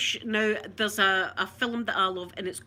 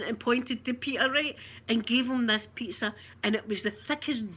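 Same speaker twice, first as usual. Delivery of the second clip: ordinary talk, nothing marked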